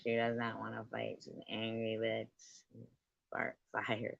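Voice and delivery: soft muttered aside; muttering. Real, not acted. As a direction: mutter it under your breath.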